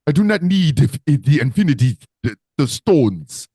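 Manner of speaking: grand villain declaring his power, punched out; deliberate, emphatic supervillain boast; African Thanos. Acted. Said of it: "one hell of an African Thanos".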